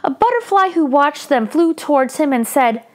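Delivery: put-on lively read-aloud for children; story narration. A narrator's reading. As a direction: tell it brightly to young listeners.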